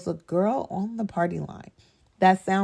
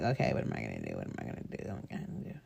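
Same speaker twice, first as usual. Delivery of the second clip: much lower, fading to a whisper; hushed, frightened self-talk dropping to a whisper; panicked inner voice; 12 dB quieter, much faster than usual. Retold or imitated. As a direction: whisper it as racing thoughts in panic. Panicked thoughts when gun appears.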